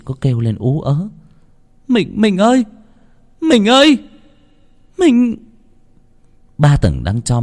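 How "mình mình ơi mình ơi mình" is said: high desperate cries for help; wife screaming for her husband. A narrator's reading. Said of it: "nghe tiếng hét của vợ".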